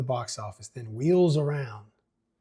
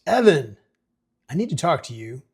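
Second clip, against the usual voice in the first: stern call; 3 semitones higher, 7 dB louder than usual. Acted. Mayor confronts son.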